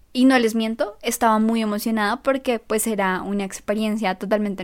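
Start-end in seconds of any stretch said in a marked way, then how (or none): none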